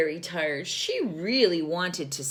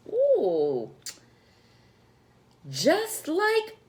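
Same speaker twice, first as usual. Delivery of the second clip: excited exclaim; 12 semitones higher, 4 dB louder, much slower than usual. Acted. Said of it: "exclaimed Layla".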